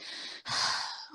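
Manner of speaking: long breathy sigh; imitated sigh. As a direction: sigh out heavily. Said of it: "people were actually just gonna be like".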